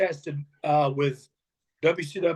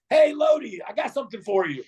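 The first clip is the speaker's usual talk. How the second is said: excited greeting; 6 semitones higher, 3 dB louder than usual. Retold or imitated. Friend calls him over excitedly.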